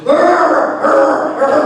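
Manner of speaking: loud, high repeated growly squawk; imitated animal noise. Girl reading suddenly hears the duck attacked, imitated animal cries. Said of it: "all of a sudden I hear".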